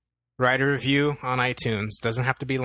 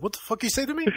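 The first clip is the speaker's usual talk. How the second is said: high, softish offended character voice; mock affronted; 11 semitones higher, 4 dB quieter, much faster than usual. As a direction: act offended, lifting into a squeak. Retells the character's affronted comeback.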